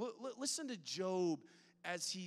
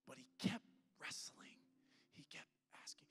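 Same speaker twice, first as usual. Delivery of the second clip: intimate whisper; 3 semitones lower, 9 dB quieter than usual. Real, not acted. Preacher telling the congregation about Job, whispered. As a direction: lean in and whisper it. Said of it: "preacher drops to whisper".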